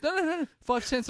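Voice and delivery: very high, urgent mock insistence; high panicked cashier voice calling after the car; imitating the cashier girl. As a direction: squeal it like the flustered girl chasing the car.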